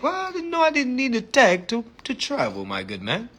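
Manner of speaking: hammed-up polite Londoner accent; put-on British accent. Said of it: "talking like a British man".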